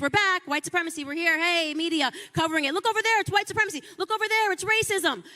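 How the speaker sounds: high, fast mock alarm, pointing and gasping; mocking hype voice. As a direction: lampoon the media crying wolf. Mocking hyped media alarm.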